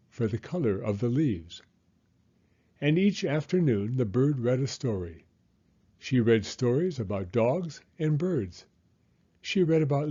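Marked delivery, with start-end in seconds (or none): none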